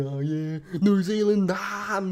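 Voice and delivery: high, loud cheer for New Zealand at the footy; mock excited sports fan; dad cheering footy. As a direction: whoop it up like a dad watching the match. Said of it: "watch the fucking football with my dad".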